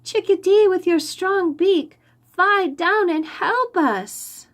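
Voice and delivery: high, loud call, slowing at the end; children pleading to the bird to help; trapped children calling up to the chickadee. A narrator's reading. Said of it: "the children called to the bird".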